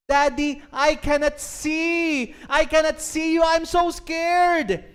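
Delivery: high, loud, slow panicked cry building to a shout; a blinded little girl screaming for her daddy; terrified child. Acted. Girl in the smoke cries to her father, terrified shout. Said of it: "She could not see anything".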